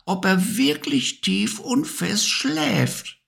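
high, light bee voice; Maya the Bee. A narrator's reading. Narrator voicing Maya the Bee for the toys, high bee voice. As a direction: buzz it out high and bright like a little bee.

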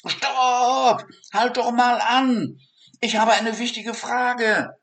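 very high, loud shout. Acted. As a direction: block her way and yell. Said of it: "rief noch lauter".

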